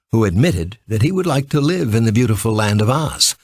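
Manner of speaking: ordinary delivery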